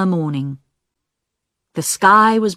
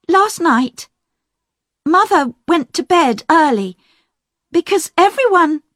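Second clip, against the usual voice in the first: put-on a young woman, much higher, urgent to confess; higher voice rushing to tell the truth; Diane, eager; 10 semitones higher than usual. A narrator's reading. Sister telling the doctor about last night, higher and urgent. Diane continues her account.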